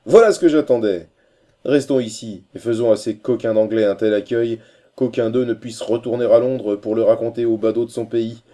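rousing exclamation. A narrator's reading. Corcoran rallying Holkar against the English, loud and exclaiming. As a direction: burst out loudly, rallying for a fight. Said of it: "s'écria Corcoran".

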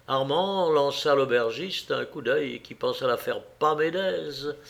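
ordinary delivery

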